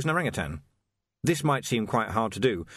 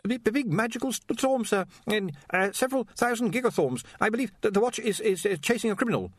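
high, stammering, anxious voice; nervous young wizard; 11 semitones higher than usual. Acted. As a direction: stumble over the words nervously. Ponder's stammering voice.

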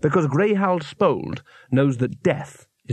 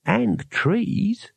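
friendly; 7 semitones higher than usual. A narrator's reading. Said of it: "said a friendly voice from the branches".

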